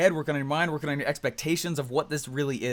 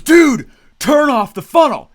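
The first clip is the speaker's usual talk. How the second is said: much higher, loud, slow and heavy; enraged demand; 10 semitones higher, 13 dB louder, slower than usual. Retold or imitated. CEO calling after two weeks of silence, slow angry demand. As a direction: lean on every word in fury. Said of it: "called me in just, like, rage".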